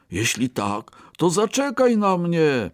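high-pitched plea to wait; calling out. A narrator's reading. Second rabbit asks fleeing friend to wait, calling out. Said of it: "zawołał drugi królik".

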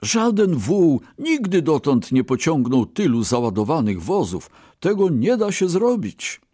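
high-pitched, emphatic disbelief; protesting exclamation. A narrator's reading. Brahmin protests to his ox, exclaiming. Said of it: "wykrzyknął Bramin".

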